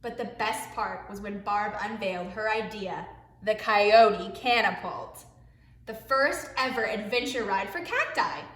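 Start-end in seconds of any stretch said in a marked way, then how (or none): none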